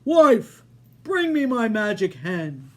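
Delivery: loud giant bellow to his wife; giant shouting. Acted. Giant calling his wife for the hen, loud. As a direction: bark the order across the room. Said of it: "Then he shouted".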